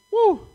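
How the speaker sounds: short, high, loud "woo"; excited whoop. Real, not acted. Preacher thrilled by peace preached to you, excited whoop. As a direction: let out a quick high whoop. Preacher exclaims after scripture line.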